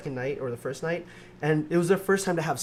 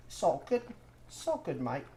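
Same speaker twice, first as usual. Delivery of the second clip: mock Australian accent; 5 dB quieter than usual. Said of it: "my accent seems to float".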